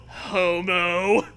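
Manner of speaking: ordinary talk, nothing marked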